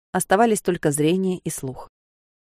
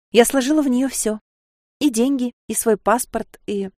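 Sofia's voice; 6 semitones higher than usual. Acted.

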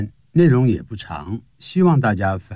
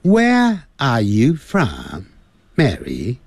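British "where" and "are", no r, slow; slow British English drill; 3 semitones higher, much slower than usual. Shown as a demonstration. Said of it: "在英式英语".

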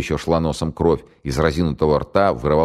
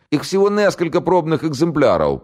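put-on much higher scared voice with a southern accent; southern Russian accent; 13 semitones higher, 3 dB louder than usual. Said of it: "по-южному выговаривая". (южнорусский говор, торопливо)

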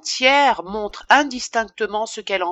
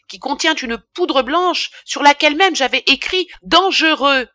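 high, loud, accusing outrage; furious scolding; 5 semitones higher, 5 dB louder than usual. A narrator's reading. Apothecary berates the boy who touched near arsenic, furious. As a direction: throw it back at him indignantly. Apothecary throws the answer back.

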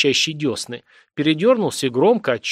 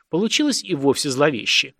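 ordinary delivery

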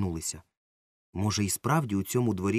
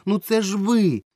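high, loud exclaiming cry; boy exclaims he recognised the actress; excited exclamation; 12 semitones higher, 7 dB louder than usual. A narrator's reading. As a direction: blurt it out with triumph. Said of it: "вигукнув Ромка".

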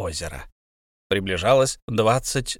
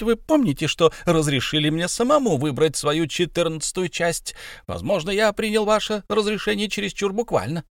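hobbit voice; 8 semitones higher than usual. A narrator's reading. Bilbo voiced by narrator.

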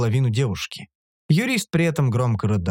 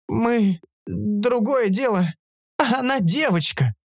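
higher-pitched, halting upset protest; tearful protest; 8 semitones higher, slower than usual. A narrator's reading. Lawyer insisting the girl is different, tearful. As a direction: object through mild tears.

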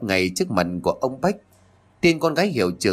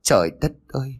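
a mildly tearful wail; mother's mild tearful lament; grieving cry; 3 semitones higher, faster than usual. Acted. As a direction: lament the lost daughter, voice pushed up. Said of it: "ngồi xuống đất mà khóc".

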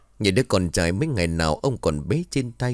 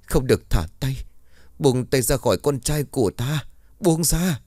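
rising, higher cry of a mother; desperate plea; 6 semitones higher than usual. A narrator's reading. Mother begging the ghost child, rising to a cry. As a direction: beg her to let go, rising to a cry. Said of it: "Bà liền mệt mỏi nói".

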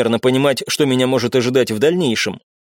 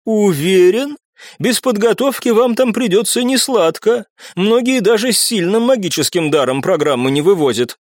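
skeptical; 7 semitones higher than usual. Acted. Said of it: "со скепсисом в голосе произнесла".

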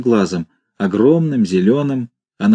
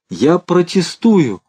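tearful cry; 6 semitones higher, much slower than usual. A narrator's reading. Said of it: "плачущим голосом закричал Фарфуркис".

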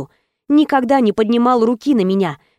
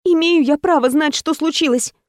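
heroine pleading; 6 semitones higher than usual. Acted.

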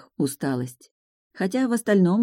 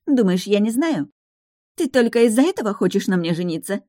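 angry; 5 semitones higher than usual. Acted. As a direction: lash out with jealous scorn. Fiancée quarrels.

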